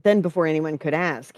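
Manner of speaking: ordinary delivery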